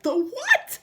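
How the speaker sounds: high, loud panicked shriek; screaming. Acted. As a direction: scream the question in terror. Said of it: "screamed Missus Twit".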